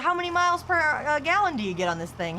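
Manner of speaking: high, loud hyped-up interviewer; mock interviewer voice. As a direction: play an eager video host. Imitating video interviewer.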